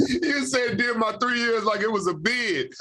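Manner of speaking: amused, high laughing delivery; laughing while teasing the player. Real, not acted. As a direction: chuckle through the teasing remark. Laughing at the 'three years' phrase.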